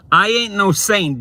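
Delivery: loud, high, emphatic denial; emphatic declaration. Real, not acted. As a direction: belt out the denial. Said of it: "repeat louder".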